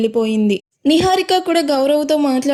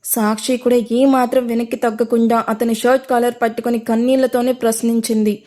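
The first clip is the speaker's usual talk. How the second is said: ordinary delivery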